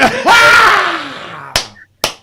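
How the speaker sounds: high loud cackle; shrieking laugh. Real, not acted. Big laugh at joke.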